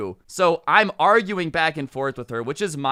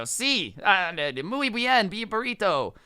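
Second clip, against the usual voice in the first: hammed-up Spanish accent, pitched higher and slow; a kid fumbling through basic Spanish, mock accent; 5 semitones higher, slower than usual. Student mock-answering in Spanish.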